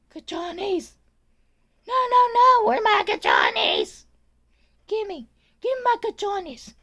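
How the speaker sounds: high-pitched hammed-up imitation, bursting loud on the demand; mock frantic Spanish-speaking lady. Aide mimicking elderly patient demanding her things, mock frantic. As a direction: squeal it like an upset old lady, drawing words out.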